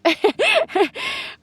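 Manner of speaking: high, breathy laugh through happy tears; tearful laugh. Real, not acted. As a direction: laugh while choking up with joy. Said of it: "these are happy tears".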